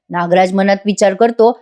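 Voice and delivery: ordinary delivery, nothing marked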